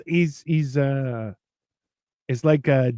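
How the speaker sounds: ordinary talk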